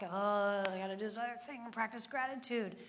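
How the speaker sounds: groaning, put-upon mock complaint; reluctant obligation. Shown as a demonstration. Someone treating gratitude as a chore, mock grumbling. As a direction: drag out a weary "oh" and grumble. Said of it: "not an obligation. Not like".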